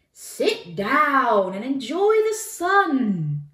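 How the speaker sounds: loud, high, slow and stretched-out; languid lion. A narrator's reading. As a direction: luxuriate in it like a basking lion. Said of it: "said the lion".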